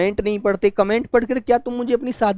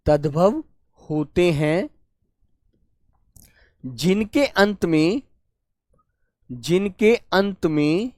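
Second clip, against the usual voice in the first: slow dictation; slower than usual. Shown as a demonstration. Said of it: "teacher dictating slowly".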